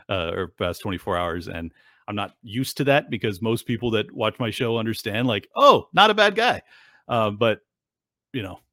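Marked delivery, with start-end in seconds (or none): 5.6-6.6 mock viewer voice. Viewers' view voiced mockingly.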